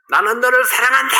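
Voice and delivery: put-on high, loud child's call; son shouting. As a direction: shout it like the boy copying his mother. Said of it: "이렇게 외쳤더니".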